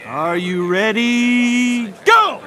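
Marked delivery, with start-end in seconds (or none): 2.0-2.4 sharp loud start call; shouting. Acted. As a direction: yell it to start the match. Starting the arm wrestling match.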